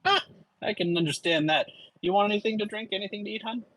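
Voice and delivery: suara tinggi dan ramah pelayan bar; high bartender voice. Acted. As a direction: tawarkan minuman dengan nada tinggi. GM keeps Leda's voice.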